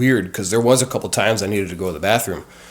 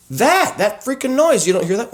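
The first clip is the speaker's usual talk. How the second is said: much higher, urgent disbelief; alarmed insisting. Retold or imitated. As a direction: press the nurse to hear it. Insisting to nurse about noise.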